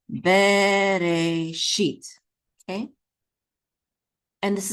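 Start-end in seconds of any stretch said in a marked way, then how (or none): 0.1-2.0 "Bereshit" very slow, each syllable held; slow syllable-by-syllable model. Shown as a demonstration. Teacher has learners say it along, drawn out. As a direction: stretch "Bereshit" into three long syllables. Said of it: "Go ahead and say that".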